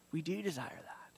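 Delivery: hushed confession, barely voiced; soft unvoiced whisper. Real, not acted. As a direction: breathe it out like a secret admission. Confessing hidden desire for vengeance.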